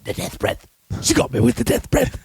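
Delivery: mock choking distress; choking character impression. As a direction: act out being felled by a stench. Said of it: "And he just goes".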